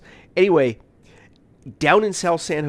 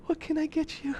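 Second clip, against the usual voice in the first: high breathless squeak; flustered reply; 9 dB quieter than usual. Retold or imitated. Coffee cart lady answering him, flustered and high. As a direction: blurt it, totally smitten.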